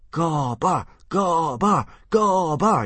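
mounting rhythmic yell; crowd yelling. A narrator's reading. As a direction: chant the name like a cheering crowd. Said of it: "yelled the crowd".

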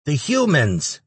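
high-pitched creature voice; frightened little demon. A narrator's reading. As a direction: squeak out the answer timidly. Cowering demon answers its captor.

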